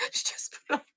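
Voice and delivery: muttered unvoiced aside; breathy aside while fussing; whisper. Real, not acted. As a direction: whisper it to yourself.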